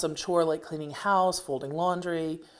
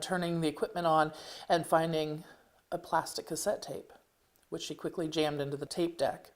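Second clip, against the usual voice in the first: usual speaking voice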